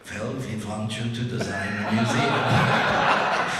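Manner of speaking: slow, loud mock-German caller; heavy German accent. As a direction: repeat it deliberately in a thick imitated German accent. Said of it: "in a very heavy German accent".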